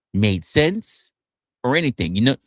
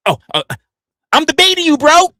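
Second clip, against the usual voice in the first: high, louder mocking bro voice; mock debate bro; 14 semitones higher, 8 dB louder than usual. Streamer mimicking a chatter, high and louder. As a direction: play the smug debate bro.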